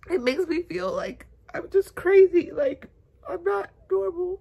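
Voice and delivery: crying it out, can't hold back; real crying. Real, not acted. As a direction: alone in bed, crying to the camera. Breaks down crying alone in bed.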